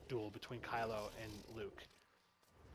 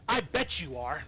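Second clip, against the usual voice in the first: mocking Kylo's line; 7 dB louder than usual. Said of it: "That's the line".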